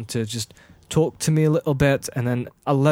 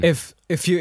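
ordinary delivery

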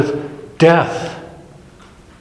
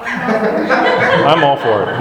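slow southern Kentucky drawl, chuckling through it; Doctor Puckett's southern drawl; 2 semitones higher, much slower than usual. Retold or imitated. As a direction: stretch "I'm all for it." out in a lazy Kentucky accent, half laughing. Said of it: "In that southern drawl".

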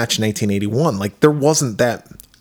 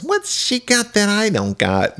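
put-on whiny girl voice; 9 semitones higher than usual. Voicing jealous girl.

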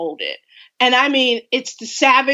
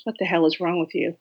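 low, quiet, quick imitation of dad; retold dismay; 5 semitones lower, 6 dB quieter, faster than usual. Dad to daughter after watching Dumplings, mock dismay. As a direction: imitate dad's hushed disbelief, low and quick. Dad's reaction to the film retold.

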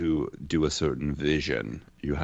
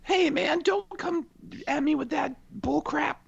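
much higher, hammed-up tough-guy voice; mean tough guy. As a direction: play the mean guy from high school drama class. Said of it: "if you're coming at me with, uh, you know, uh, fucking, uh, Poe, uh, voice-wise".

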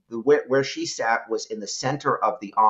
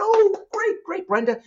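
mock delight; 3 dB louder than usual. Boss hears copier was moved.

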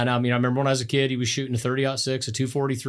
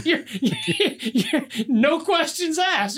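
laughing; much slower than usual. Real, not acted. Laughing while teasing dad.

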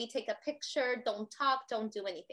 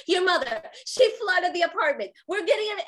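frantic; 6 semitones higher, 7 dB louder than usual. Retold or imitated. Husband panicking in bathroom.

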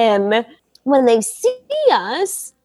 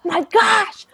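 mock yelp of pain; 11 semitones higher, much slower than usual. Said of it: "He's like".

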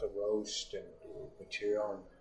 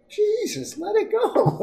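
much higher, louder mock exasperation; exasperated friend impression; 10 dB louder, slower than usual. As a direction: throw up your hands, tell him to drop it. Patrice exasperated, imitated high.

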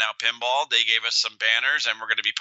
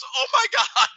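much higher, sudden realization squeal. Retold or imitated. Scene: Sanjay finally getting Bruce's joke, high squeal. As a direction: squeak it out as the penny drops. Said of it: "He looks at the guy and goes".

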